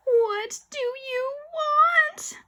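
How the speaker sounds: very high, slow quavering question; quivering voice. A narrator's reading. Boy questions the ghost, frightened quivering voice. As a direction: quaver it out fearfully. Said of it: "my voice quivering".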